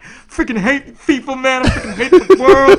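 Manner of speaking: high, loud mock rage, drawn out; hammed-up frustrated yell about people and the game; pissed-off rant. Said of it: "times I'm just pissed off".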